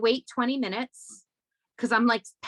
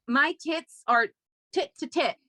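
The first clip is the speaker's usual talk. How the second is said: usual speaking voice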